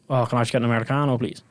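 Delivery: rattled off fast in a rush; hurried Dublin order, words run together; quick Irish rush. Retold or imitated. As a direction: fire the order off fast, all one breath. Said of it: "I tend to talk a bit quicker".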